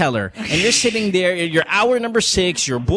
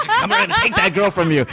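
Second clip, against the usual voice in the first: rival guy voice; 11 semitones higher than usual. Retold or imitated. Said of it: "Jake Cuenca".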